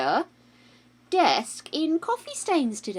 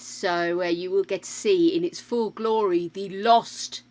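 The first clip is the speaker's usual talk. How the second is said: normal talking voice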